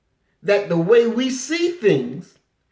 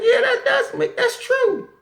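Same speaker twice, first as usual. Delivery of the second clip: gullible frog; 6 semitones higher than usual. Retold or imitated. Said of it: "he's like".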